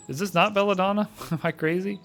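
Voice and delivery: high, loud, puzzled question; baffled and amused; surprised disbelief. Real, not acted. Confused at the herb.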